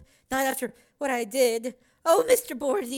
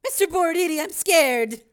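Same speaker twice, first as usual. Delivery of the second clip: louder frightened plea; scared cry; 4 semitones higher, 5 dB louder than usual. A narrator's reading. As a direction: cry out to him in fear. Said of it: "cried Corey".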